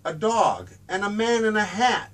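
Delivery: plain reading voice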